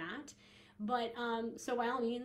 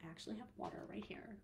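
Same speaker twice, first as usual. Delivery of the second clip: a quick, hushed whisper; whispered aside; 11 dB quieter, faster than usual. Real, not acted. Podcast host whispering about her water bottle nearby. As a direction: let the listeners in on a little secret.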